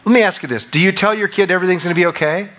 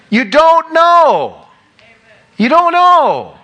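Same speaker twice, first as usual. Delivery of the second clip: emphatic shout; 14 semitones higher, 7 dB louder, much slower than usual. Real, not acted. Preacher's emphatic repeated point.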